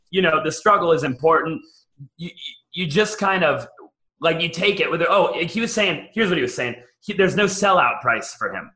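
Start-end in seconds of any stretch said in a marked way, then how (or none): none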